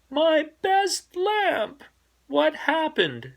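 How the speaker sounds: high wailing dismay; dismayed Mama. Acted. Mama finding her lamp broken, high dismayed wail. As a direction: cry out over the broken lamp.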